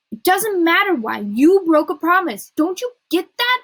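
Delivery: high, loud, exasperated rebuke; angry reproach. A narrator's reading. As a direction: drive home the betrayal. Quarrel over broken promise.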